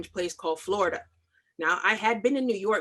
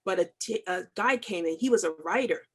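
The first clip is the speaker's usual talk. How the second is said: ordinary talk, nothing marked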